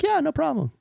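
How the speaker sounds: high-pitched, easy agreement; breezy front desk. Retold or imitated. Front desk answering a dog owner, high breezy reply. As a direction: lift the voice into a ready yes.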